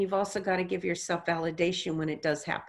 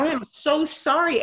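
higher and louder, a flustered apology; mortified apology; 9 semitones higher, 6 dB louder than usual. Retold or imitated. Psychic apologizing to the widow for cursing, mortified. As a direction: blurt out a mortified sorry.

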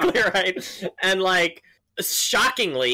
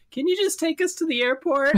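exasperated plea; 13 semitones higher than usual. Retold or imitated. Silently begging cab driver.

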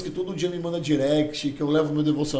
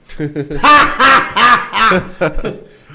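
big, loud ha-ha-ha; high, loud staccato laugh; loud belly laugh; 5 semitones higher, 13 dB louder than usual. Retold or imitated. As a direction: burst out in booming laughter. Laughs after quoting Cariani.

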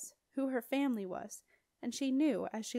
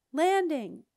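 loud, raised one-word shout; yelled; 6 semitones higher, 8 dB louder than usual. A narrator's reading. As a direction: yell the answer back. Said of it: "yelled Septimus".

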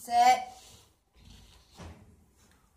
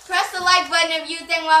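hyped announcement; 4 semitones higher, 6 dB louder than usual. Real, not acted. Vlog intro announcement.

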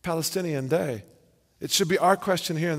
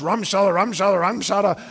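mock tongues. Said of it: "fake tongues".

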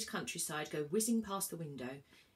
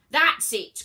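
very loud, higher outburst; crying out; 8 semitones higher, 14 dB louder than usual. A narrator's reading. Inspector loses his temper, loud outburst. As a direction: lose your temper completely. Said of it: "cried the man".

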